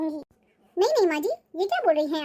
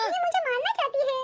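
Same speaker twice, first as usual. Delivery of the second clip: put-on higher pitched old woman's wail of dismay; mock outrage; 8 semitones higher than usual. Grandmother wailing at her daughter-in-law's food, higher and hammed up. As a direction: cry out to the heavens about the food, hammed up.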